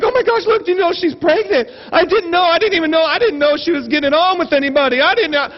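high-pitched, breathless run of gossip; mock overexcited. Two friends gossiping over a magazine, mock overexcited. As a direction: imitate a gushing, freaked-out friend. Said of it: "she's just so excited".

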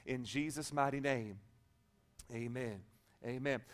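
low, soft public prayer. Real, not acted. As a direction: pray quietly and earnestly, fading to a hushed amen.

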